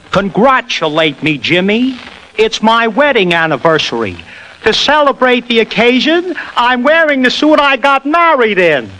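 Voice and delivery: rising gradually to a high tenor. Shown as a demonstration. Mimic shows host how he finds the voice level, climbing gradually into a tenor. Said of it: "gradually raise my voice and experiment like this".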